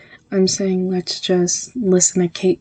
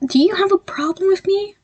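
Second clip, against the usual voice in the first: demanding; 12 semitones higher than usual. Acted. Demanding an answer.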